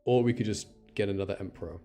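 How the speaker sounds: ordinary talk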